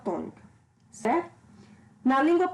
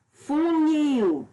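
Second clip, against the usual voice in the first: "funil" with NIL drawn out and higher; stress on last syllable; 4 semitones higher, 3 dB louder than usual. Shown as a demonstration. Teacher showing pupils the stressed syllable, stress on last syllable. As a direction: lean hard on the final NIL of "funil". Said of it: "é a mais forte".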